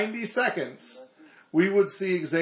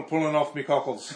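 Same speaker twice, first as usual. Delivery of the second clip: mock Braveheart accent. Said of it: "Do I get to yell freedom".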